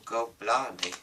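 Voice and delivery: ordinary delivery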